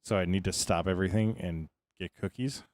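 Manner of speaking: ordinary talk, nothing marked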